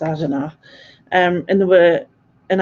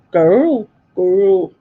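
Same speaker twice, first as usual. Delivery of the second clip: hammed-up Scottish "girl", long and falling; badly faked Scottish accent; attempted Scottish accent; 5 dB louder than usual. Shown as a demonstration. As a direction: have a clumsy go at a Scottish "girl". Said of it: "I can't do accents".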